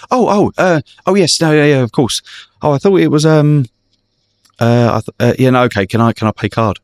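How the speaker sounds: high, stammering flustered passenger; mock panic, caught out; a hammed-up fluster of a caught-out customer; flustered woman.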